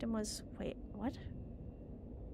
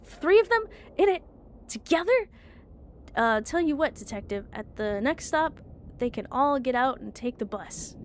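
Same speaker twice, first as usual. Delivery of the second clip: character voice; 4 semitones higher, 8 dB louder than usual. Acted.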